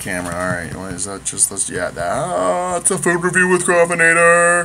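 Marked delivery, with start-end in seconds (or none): 1.9-4.6 high, loud, slow showman intro; announcer voice. Acted. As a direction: announce it like a TV host.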